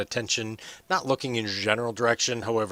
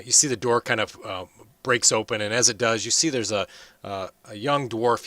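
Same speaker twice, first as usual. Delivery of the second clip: usual speaking voice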